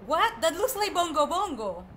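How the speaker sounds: ordinary delivery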